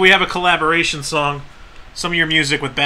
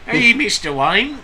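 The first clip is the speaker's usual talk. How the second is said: put-on British accent turned Australian; 6 semitones higher, slower than usual. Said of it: "can't do a British accent".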